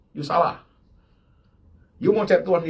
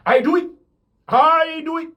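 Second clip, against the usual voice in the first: loud and higher, insisting; emphatic declaration; 11 semitones higher, 6 dB louder than usual. Real, not acted. Preacher insists to the congregation he lives it. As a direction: proclaim it and build on the repeat.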